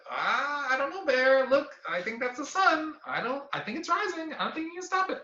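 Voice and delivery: high, small, hesitant chipmunk voice; doubtful chipmunk. Acted. Chipmunk gently telling the bear the sun is rising. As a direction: point it out timidly, unsure of yourself.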